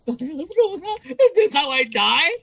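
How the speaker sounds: thinking he'd die at the event, high mock alarm; mock terror. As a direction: panic in a squeaky voice. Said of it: "I'm like".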